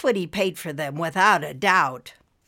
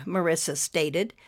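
ordinary delivery, nothing marked

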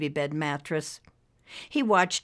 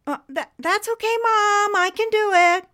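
nervous hurry; 7 dB louder than usual. Acted. Nervous refusal to mom.

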